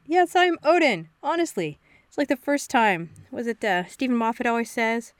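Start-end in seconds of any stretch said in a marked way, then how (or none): 0.1-1.8 high, hammy fake god voice; mock Odin. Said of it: "the Doctor pretending to be Odin".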